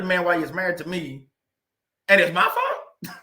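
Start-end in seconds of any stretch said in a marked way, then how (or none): 2.1-3.2 laughing disbelief. Real, not acted. Disbelief at ex-wife's claim.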